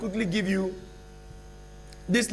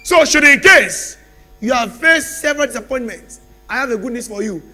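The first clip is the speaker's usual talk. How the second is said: loud, rising sermon bursts with long pauses; preaching at full force; 2 semitones higher, 6 dB louder than usual. Acted. Preacher promising the congregation change, loud and rising. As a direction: drive the promise home to the church.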